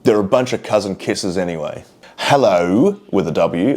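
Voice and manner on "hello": sing-song ad-man hello, swooping up at the end; Frank Walker. Acted. Vlogger reading a comment like a tile advert, swooping ad-man impression. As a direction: draw out a hello that dips, then rises. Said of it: "like fucking Frank Walker from National Tiles".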